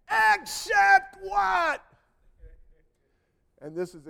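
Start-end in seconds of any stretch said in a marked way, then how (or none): 0.1-1.8 high, slow drawn-out yell; screaming. Retold or imitated. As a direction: scream it back in anger. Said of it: "And he screams at me".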